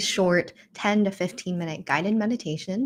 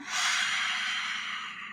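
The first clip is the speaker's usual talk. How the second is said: long soft unvoiced exhale; relaxed breathy release; audible sigh; 7 dB quieter than usual. Shown as a demonstration. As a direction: sigh it all out slowly. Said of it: "sigh it out this time".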